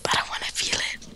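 creepy whisper on request; hushed and suggestive. Pretend. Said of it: "what'd you say again".

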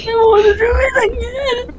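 high, tearful mock plea; whiny retold protest. Retold answer to scolding parent.

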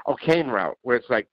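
usual speaking voice

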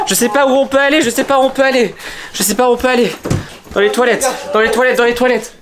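high, frantic panic; panicked. Acted. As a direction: blurt it out in a panicked rush. Fleeing the monster in panic.

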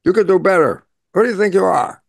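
a hint of a critical parent's rebuke; mock scolding parent. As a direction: throw it down at the child like a stern parent. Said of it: "quotes that were downloaded into you as parents".

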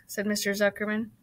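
ordinary delivery, nothing marked

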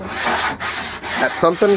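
slow breathy disbelieving echo; incredulous whisper. Real, not acted.

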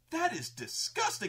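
high-pitched imitation of appalled players; disturbed disgust. Players to game master, retold disgust. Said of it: "the party was really disturbed by this".

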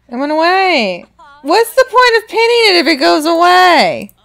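gasp, then loud high exasperation; high-pitched, indignant yelling; indignant outburst. Real, not acted. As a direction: cry out in exasperated protest. Outburst at vanished pinned option.

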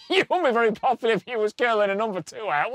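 ordinary talk, nothing marked

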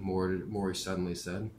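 plain reading voice

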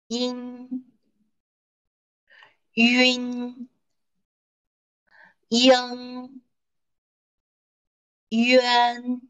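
teacher drilling syllables for students to repeat; repeat-after-me drill. Shown as a demonstration. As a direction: pause after each for the class to echo. Leads a choral drill.

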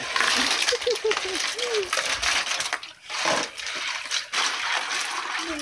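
high breathless laugh; shrieking laugh. Real, not acted. Ice poured, loud unvoiced laugh.

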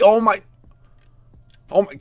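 usual speaking voice

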